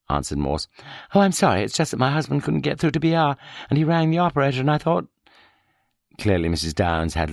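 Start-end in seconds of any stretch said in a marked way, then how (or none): 1.1-5.1 higher, fluttery and flustered; lightly voiced muddled housewife on the phone; higher, hesitant and apologetic; confused wife. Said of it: "Her manner was rather endearingly confused".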